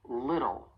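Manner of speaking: American "little" with a flapped T. Shown as a demonstration. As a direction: soften the T in "little" to a quick tap. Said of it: "A flap T may also occur".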